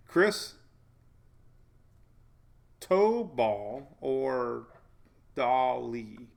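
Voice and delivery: "Chris Tobal" broken in two and drawn out, then "Dolly" slowly; exaggerated pronunciation. Shown as a demonstration. Summing up the name choices for viewers, exaggerated pronunciation. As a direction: split "Chris" from "Tobal" with a long pause, "to" stretched. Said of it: "pronunciation guide".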